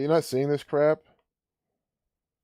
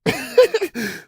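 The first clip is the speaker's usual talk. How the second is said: scornful laugh; 13 semitones higher, 5 dB louder than usual. Real, not acted. Mocking laugh at news clip.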